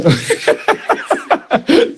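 a high, amused laugh. Real, not acted. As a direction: laugh freely at the mishap. Laughing at break.

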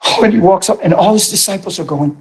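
ordinary talk

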